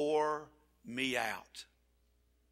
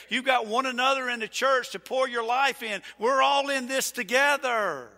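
rising higher and louder to a ringing peak; full-force preaching; 10 semitones higher, 8 dB louder than usual. Real, not acted. Pastor rallying the congregation, building to a loud climax. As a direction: build it line by line into a shout.

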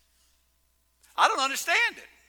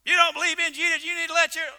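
higher, louder mock-angry scolding; mock angry preaching; 8 semitones higher, 6 dB louder, faster than usual. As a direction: parody a preacher who rants. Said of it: "we preach angry".

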